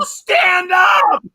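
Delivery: high, exasperated captain's shout; hammed-up scolding command; mock yelling. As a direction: yell the order at full voice. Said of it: "The captain yells at him".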